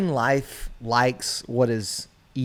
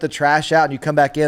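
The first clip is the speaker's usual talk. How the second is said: ordinary talk, nothing marked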